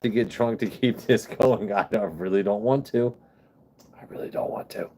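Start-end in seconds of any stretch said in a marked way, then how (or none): 4.0-4.9 whispered aside under his breath. Real, not acted. Streamer whispering to himself about not wanting to drink.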